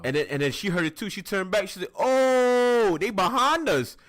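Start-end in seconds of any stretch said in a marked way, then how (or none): 2.0-4.0 high, loud alarmed yell; drawn-out panicked "oh", acted big; scared cry. Mage turns and finds goblins behind, hammed-up alarm. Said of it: "So she gets scared".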